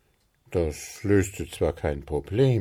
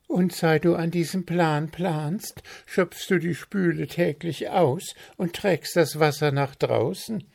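higher, louder teasing friend voice; teasing Zwitschi; 11 semitones higher, 4 dB louder than usual. Acted. Zwitschi teases Puh about the sink, higher and louder. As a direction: poke fun at his endless planning.

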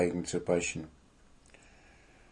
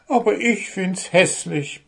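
high, mildly sulky gnome; a hint of sulking, high; complaining; 8 semitones higher than usual. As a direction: grumble it. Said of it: "beklagte sich Puh".

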